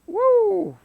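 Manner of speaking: loud, high preacher's whoop; a sudden high woo from the pulpit. Real, not acted. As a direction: let out a joyful whoop. Preacher's exclamation.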